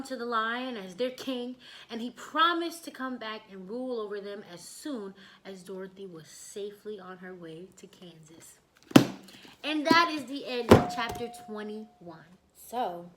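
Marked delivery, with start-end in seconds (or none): 5.4-8.6 unvoiced soft whisper. A narrator's reading. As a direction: whisper it gently. Whispered closing line.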